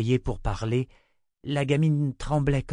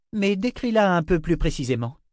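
impatient sister; 8 semitones higher, 4 dB louder than usual. Acted. Sister presses innkeeper.

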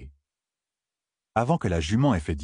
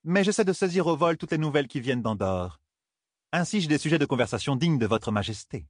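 higher-pitched lady-in-waiting feigning deference; scheming courtier's higher voice; needling courtier; 6 semitones higher than usual. Acted. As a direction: pitch it up, needle the queen under cover of courtesy.

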